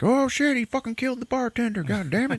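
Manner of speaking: high, alarmed squeal; hammed-up scared onlookers; mock panicked crowd. Crowd reacts to the killing.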